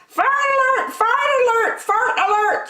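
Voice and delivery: very high squawking parrot. A narrator's reading. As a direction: screech it like a parrot. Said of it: "squawked the parrot".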